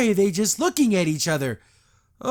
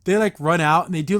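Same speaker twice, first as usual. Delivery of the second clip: ordinary talk, nothing marked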